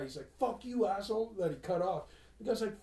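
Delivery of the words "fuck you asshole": higher mock yell; retold yell. Driver yelling at the guy he cut off, retold yell. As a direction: imitate a driver yelling, not at full volume. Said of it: "he yells at the guy".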